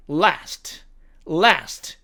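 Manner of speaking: American "last", front a; American demo of "last"; American accent. Shown as a demonstration. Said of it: "미국식 발음으로는".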